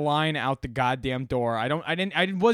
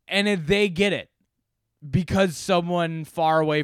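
heated rant; 6 semitones higher, 5 dB louder than usual. Real, not acted. Ranting about the fine.

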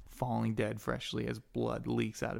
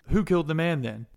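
loud, higher demand; acted forceful guard question; guard interrogation; 5 semitones higher, 8 dB louder than usual. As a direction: press the suspect for an answer.

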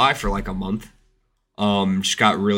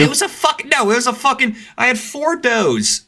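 exasperated outburst; 4 dB louder than usual. Real, not acted. Angry retelling of missed shot.

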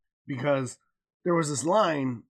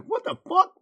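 outraged queue; 4 dB louder than usual. Retold or imitated. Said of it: "Line next to us was like".

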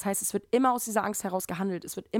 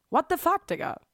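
much higher and louder, exasperated; indignant outburst; 9 semitones higher, 3 dB louder than usual. Real, not acted. Vlogger mocking fear of others' opinions, exasperated. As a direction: throw the phrase out in disbelief.

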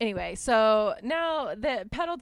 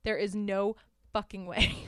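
ordinary talk

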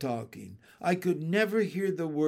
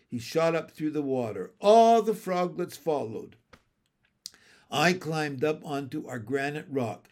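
plain reading voice

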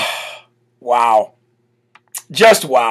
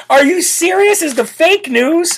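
incredulous; 10 semitones higher than usual. Real, not acted. Incredulous at the reporter.

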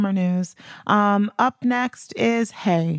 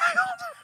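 sudden high laugh; burst of laughter; 5 dB quieter than usual. Real, not acted. She laughs at how wild the rent is.

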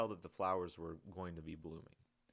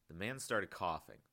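usual speaking voice

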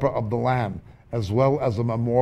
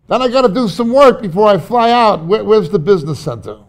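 hurried traveller; 8 semitones higher, 9 dB louder than usual. Acted. Acting out airport hassle.

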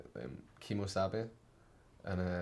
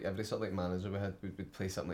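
ordinary talk, nothing marked

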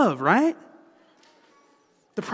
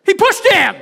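high yelling protest from the stands; sudden courtside shout; shouted at referee; 12 semitones higher, 8 dB louder than usual. Retold or imitated. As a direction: bellow it at the ref.

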